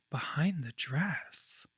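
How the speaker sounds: eerie whisper, very soft. Acted. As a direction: breathe it like a ghost. Repeating the ghost's words.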